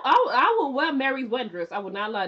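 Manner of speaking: ordinary talk, nothing marked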